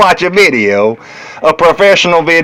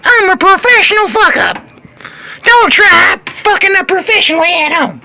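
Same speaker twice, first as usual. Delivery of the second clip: excited high declaration; 13 semitones higher than usual. Acted. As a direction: announce it like a showman.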